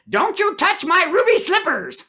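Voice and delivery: high, sharp possessive starlet; [name] voice. Pretend. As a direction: snap it like Dorothy. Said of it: "[name] said".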